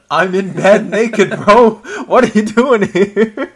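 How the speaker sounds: loud, high mock outrage through laughter; hammed-up protest at being walked in on; flustered protest. Gambit protests at intrusion while naked.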